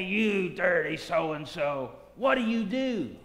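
slow, snarling slur in character; [name] acting a bigot, taunting Jackie; mock taunt. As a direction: sneer it slowly at him. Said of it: "and I say".

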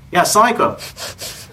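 lightly acted upbeat hello; upbeat greeting. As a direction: imitate a friend answering cheerfully. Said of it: "he was so upbeat and positive".

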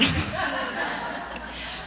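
a quick airy laugh at her own joke; soft breathy laugh. Real, not acted. Preacher laughing at her own joke to the crowd, soft airy laugh. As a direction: let a light laugh slip out.

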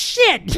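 sharp high laugh; high burst of laughter. Real, not acted. Dad laughing at the near miss, high laugh.

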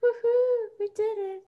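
high joyful whoop; excited cheer. Real, not acted. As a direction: cheer like you just won. Cheering at the end.

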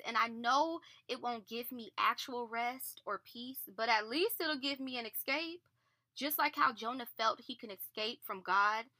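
ordinary talk

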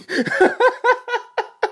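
long, high laughing fit; cracking up. Real, not acted. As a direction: crack up at your own punchline. Cracks up at punchline.

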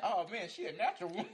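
high and loud, laughing through a mock boy's line; a giddy impression of the boy, cracking up. Friend plays the boy admiring her, laughing high and loud.

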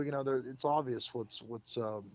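ordinary delivery

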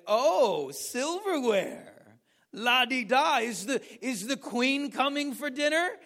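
loud, high, drawn-out sneering "silverware"; mocking posh English lady. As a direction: ham up a snooty English put-down. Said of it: "she started mocking us out loud".

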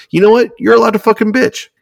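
fired-up podcast host; excited outburst. Real, not acted. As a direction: burst out with fired-up permission. Excited burst while ranting.

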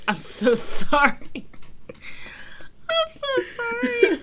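high, slow laughing apology; laughing mock apology. Teasing fake apology.

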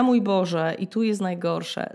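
ordinary delivery

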